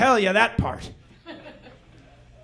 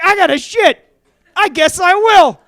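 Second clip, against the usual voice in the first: put-on higher, louder, matter-of-fact kid; blunt little kid; 5 semitones higher, 7 dB louder than usual. Little kid deciding to just go, higher and louder. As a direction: shrug it off like a toddler.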